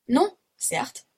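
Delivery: high-pitched, emphatic denial; emphatic exclamation. Acted. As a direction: cry out the refusal. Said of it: "s'écria Paganel".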